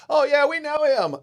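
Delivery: imitating an excited crowd, high and loud; enthusiastic group greeting. VIP crowd answering the introduction, acted excited recognition. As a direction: pitch it way up, loud mock recognition. Room greets him as known.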